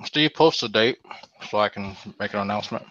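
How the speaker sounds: ordinary talk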